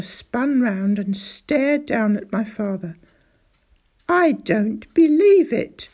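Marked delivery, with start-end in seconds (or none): 4.1-5.9 loud, higher cry of disbelief, slowly; cried out. Acted. Doctor hears of the pit and cries out, loud. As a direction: burst out in shocked disbelief. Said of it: "he cried".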